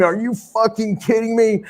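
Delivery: high-pitched outraged yell; retold screaming. Wrestler finds a lock on his glasses, high outraged yell. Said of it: "he came out screaming".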